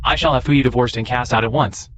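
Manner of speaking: ordinary talk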